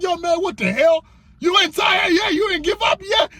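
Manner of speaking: high-pitched mock disbelief; incredulous questions climbing high; exasperated girlfriend. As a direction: imitate a partner who cannot believe it. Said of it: "Your girl looking at you crazy like".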